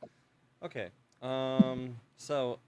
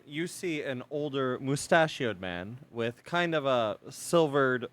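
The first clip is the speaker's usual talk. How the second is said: ordinary delivery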